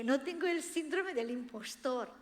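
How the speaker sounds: normal talking voice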